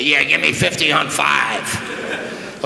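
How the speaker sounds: very slow disguised voice; slow mock voice for the cashier; put-on voice. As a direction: drag out every word to stay in character. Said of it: "I have to talk like this".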